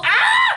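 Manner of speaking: sudden high-pitched squeal. Real, not acted. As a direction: let out a high playful squeal.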